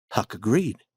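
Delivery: ordinary delivery, nothing marked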